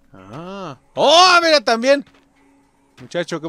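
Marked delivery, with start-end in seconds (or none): 1.0-2.0 high, loud exclamation; surprised yell. Real, not acted. Player spotting someone else, surprised yell. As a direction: burst out in surprise.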